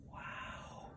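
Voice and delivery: hushed, whispered awe; imitating a quietly amazed officer; almost impressed. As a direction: breathe it out very softly, impressed. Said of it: "he was almost impressed".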